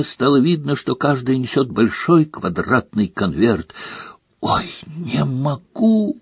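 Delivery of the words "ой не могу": soft, slow, squeaky whisper; squeak. Acted. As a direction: squeak it out with nerves. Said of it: "пропищала Гермиона".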